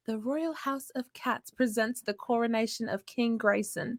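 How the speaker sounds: lightly posh British herald reading it out; British accent. Pretend. Producer reads the title for host in British accent. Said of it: "could you say that in your British accent".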